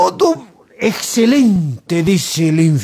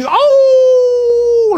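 loud, very high drawn-out wolf howl; 5 dB louder than usual. Retold or imitated. Storyteller imitates the howls heard inside hell, howling. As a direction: let out a long, soaring imitation howl. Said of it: "aullidos, dice".

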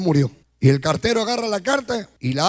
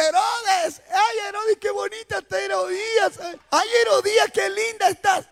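mock flattering crowd; 10 semitones higher than usual. Townspeople flattering Herod and Herodias, mock gushing in high voices. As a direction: coo admiringly in a put-on high voice.

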